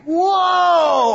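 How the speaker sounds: high, loud hammed-up whoa; mock whoa. Preacher lampooning a casual exclamation, loud and high. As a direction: blurt it like a startled cartoon.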